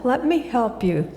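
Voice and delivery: ordinary talk, nothing marked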